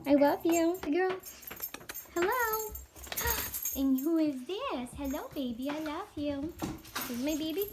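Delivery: high, cooing pet voice. Real, not acted. Owner greeting her pets, high cooing baby talk. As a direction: coo lovingly at the animals.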